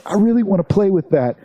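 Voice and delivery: high mock inner voice; inner voice quote. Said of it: "it's like".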